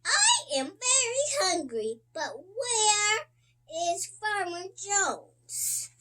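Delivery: a hungry cow complaining, high and quick. A narrator's reading. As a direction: play the cow asking for its farmer. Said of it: "says the cow".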